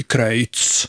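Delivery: Kreutz as "Kröjts", a little lengthened. Shown as a demonstration. Host stating his choice, Kreutz with Swedish öj. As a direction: round the diphthong in Kreutz to öj. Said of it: "säga det med öj".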